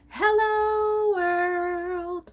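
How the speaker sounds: high, slow host announcement; slow high-pitched show opener; show intro. Real, not acted. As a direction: draw out the welcome like a host.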